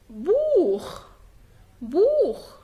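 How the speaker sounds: بوخ as a long "boookh"; isolated بوخ, long vowel then "kh". Shown as a demonstration. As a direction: rasp the end of بوخ in the throat. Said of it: "بينطق خ".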